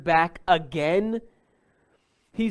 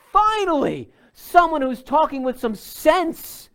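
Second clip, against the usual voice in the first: much higher and louder, eager; relieved approval; 11 semitones higher, 6 dB louder than usual. Retold or imitated. Pharisees cheering the older son, high eager relief. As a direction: cheer him on at last. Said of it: "They would have said to themselves".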